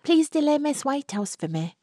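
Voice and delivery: high, sweetly pleading ask; coaxing young woman. Acted. Florrie asks Albert to delay the chaperone, high. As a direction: wheedle the favour out of him.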